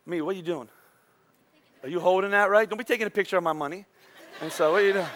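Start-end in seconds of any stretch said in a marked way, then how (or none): none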